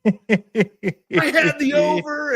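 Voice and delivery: slow, high voice laughing through the words; laughing imitation. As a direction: chuckle it out like a gambler remembering a win. Pete Rose voiced in story.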